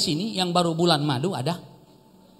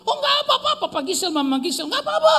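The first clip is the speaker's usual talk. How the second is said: much higher, laughing, kindly neighbour; cheerful neighbour; 8 semitones higher than usual. Retold or imitated. Old neighbour reassures the pastor couple, high and laughing. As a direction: play the laughing friendly neighbour.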